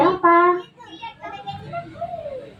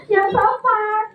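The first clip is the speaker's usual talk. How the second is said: ordinary delivery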